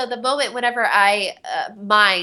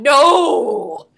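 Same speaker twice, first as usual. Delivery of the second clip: retold shout; 5 semitones higher, 6 dB louder than usual. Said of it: "so I'm talking like this".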